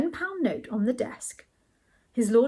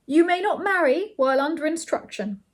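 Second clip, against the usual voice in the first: firm, higher and louder pronouncement; 7 semitones higher, 5 dB louder than usual. A narrator's reading. His lordship warning a new pupil about marriage, firm. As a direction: lay down the rule firmly. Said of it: "he said firmly".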